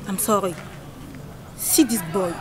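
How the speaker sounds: usual speaking voice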